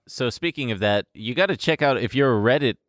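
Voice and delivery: normal talking voice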